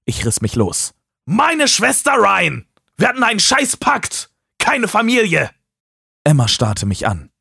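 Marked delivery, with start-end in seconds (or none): 1.3-5.5 much higher, raging accusation; furious outburst. Acted. Taylor rages at Ryan over the broken pact. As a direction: explode at the betrayal. Taylor yells at his friend.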